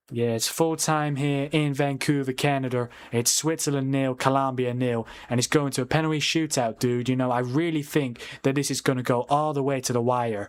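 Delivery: put-on American sportscaster patter; American accent. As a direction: report the score in a fake American accent. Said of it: "the accent I'm doing".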